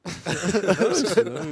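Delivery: high, loud laughing yes. Real, not acted. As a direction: laugh through the words. Laughing at joke about mindset.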